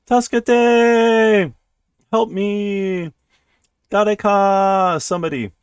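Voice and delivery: high, drawn-out call for help; acted cries of distress, easing off; cry for help. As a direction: call out desperately, stretching the endings. Acting character's cries for help.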